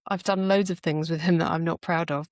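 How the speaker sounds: ordinary talk, nothing marked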